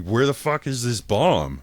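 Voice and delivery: high, loud and slow; exasperated demand. Retold or imitated. Demanding from staff where the bomb is, high and loud. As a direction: drag it out in loud disbelief.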